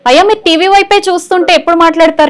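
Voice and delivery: high, loud telling-off. Real, not acted. Host chides caller watching the TV, loud and high. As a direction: push the voice up to get through to the caller.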